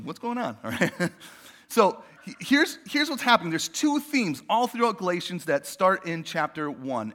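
ordinary delivery

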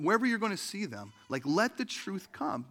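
normal talking voice

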